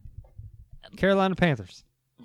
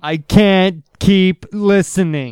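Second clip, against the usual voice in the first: mock exasperation; 5 semitones higher, 9 dB louder, much slower than usual. Mock disgust at friend.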